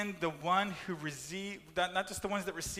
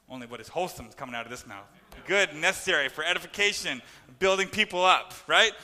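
excited; 8 dB louder, faster than usual. Real, not acted. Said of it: "Just got a little excited there".

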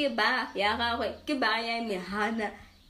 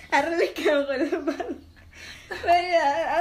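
laughing; 7 semitones higher, 8 dB louder than usual. Real, not acted. Embarrassed about first episode.

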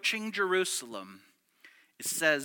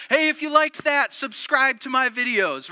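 bright hammed-up YouTuber pitch, higher and louder; mock influencer plug; 7 semitones higher, 7 dB louder than usual. Preacher mocking a viral-video plug, hammed-up influencer voice. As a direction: sell it like a cheesy video outro.

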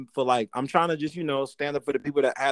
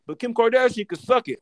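mocking voice; 11 semitones higher than usual. Mocking hypocrites' argument.

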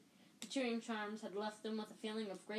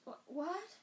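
jolted awake, pitch jumps up; sudden confused wake-up; a startled squeak; 8 semitones higher than usual. Acted. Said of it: "waking with a start".

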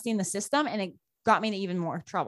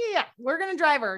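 higher-pitched retold insistence; imitating parents brushing off the question; insistent confirmation; 6 semitones higher than usual. Parents insist after being questioned.

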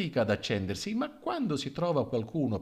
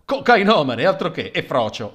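gossip's loud correction; 5 semitones higher, 11 dB louder than usual. Acted.

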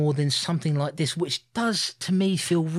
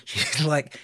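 usual speaking voice

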